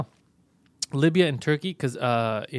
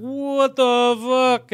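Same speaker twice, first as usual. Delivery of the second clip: drawn-out startled what the fuck, higher and louder; shocked outburst; 8 semitones higher, 6 dB louder, much slower than usual. Retold or imitated. Recalling his own shock at nearly slapping her, stunned. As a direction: blurt it out slow and stunned.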